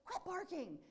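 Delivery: high calling shout to the dog; yelling out the window. Retold or imitated. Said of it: "yelling out the window".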